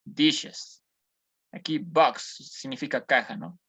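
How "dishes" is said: "Dishes" said in English, with the "-es" syllable; English demo of "Dishes"; English pronunciation. Shown as a demonstration. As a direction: model "Dishes" for the class. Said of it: "añadiendo una E S".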